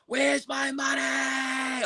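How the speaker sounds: high, slow, drawn-out scream of the question; screaming at the top of his lungs. Retold or imitated. Boss screaming at the beaten man over his missing money. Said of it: "on the top of his lungs".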